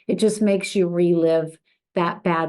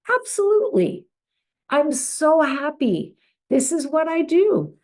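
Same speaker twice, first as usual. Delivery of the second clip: gracious reply; 7 semitones higher than usual. Acted. Agent's gracious reply, acted.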